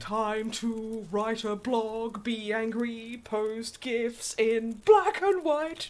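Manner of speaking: hammed-up angsty villain; mock edgy Sephiroth. Voicing Sephiroth planning an edgy blog, hammed-up.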